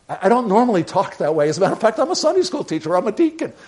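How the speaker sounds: high, loud, fast flustered excuses; a caught-out churchgoer squeaking out his credentials; flustered golfer. Retold or imitated. Golfer caught cursing explains himself to the pastor, flustered high and loud.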